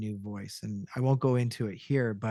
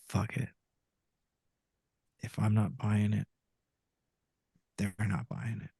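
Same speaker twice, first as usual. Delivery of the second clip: low and soft, giving up; defeated murmur; 6 semitones lower, 7 dB quieter than usual. Retold or imitated. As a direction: let it drop, resigned and quiet. Giving up at the microphone.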